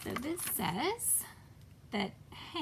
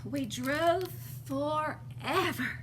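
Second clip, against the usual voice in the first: slow, stretched-out and louder; long weary road narration; drawn out; 4 dB louder, much slower than usual. A narrator's reading. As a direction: drag the words out like an endless drive.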